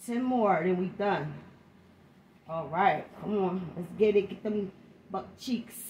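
ordinary talk

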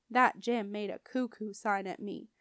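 ordinary delivery

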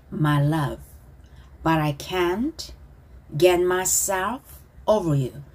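slow, deliberate lyric reading; slow pronunciation model. Shown as a demonstration. Teacher leading students in repetition, slow and measured. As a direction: draw out each phrase for repeating. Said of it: "저를 보고 발음 따라하기".

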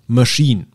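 Machine said mashin, English; French-style sch. Shown as a demonstration. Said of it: "eher wie so ein S C H ausgesprochen".

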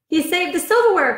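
high delighted exclamation; excited cry. Acted. Mother thrilled the dog saved the silverware, crying out high. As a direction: cry it out with joy. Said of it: "cried Mother".

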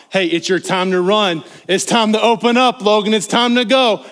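loud, high rallying cry; urging shout. Acted. Pastor spurring a runner on to go, urging shout.

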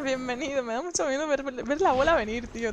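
ordinary delivery

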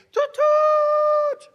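very high, loud imitated train horn; piercing locomotive whistle imitation; yelled train whistle. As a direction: blast a short toot, then a long held one.

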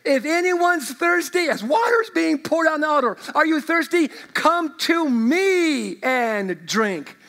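proclaiming call. Acted. Preacher voicing Jesus crying out.

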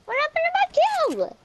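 very high, stretched wail of complaint; high, indignant squeal about the game; high-pitched outcry. Real, not acted. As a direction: cry it out high in protest. Shouted complaint over lost game kills.

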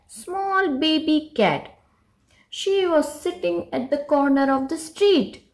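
plain reading voice

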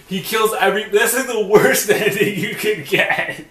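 high, slow whine through laughter; laughing complaint. Real, not acted. Player calling the ending the worst, laughing complaint. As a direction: complain while cracking up.

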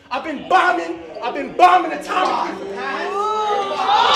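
rising from strong to shouted; building shout. Acted. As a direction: build it up into a yell. Battle rap verse building up.

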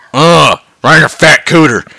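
usual speaking voice

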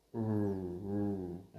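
"r" held as a continuous English r hum; isolated R sound. Shown as a demonstration. Teacher showing the letter R sound, held demo. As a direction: sustain "r" by itself, twice. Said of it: "là chữ R đó".

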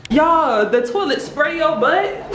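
cracking up in disbelief; shocked giggle about the toilet; laughing. Real, not acted.

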